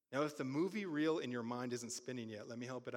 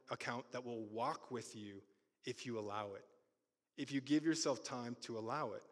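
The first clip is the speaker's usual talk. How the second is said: usual speaking voice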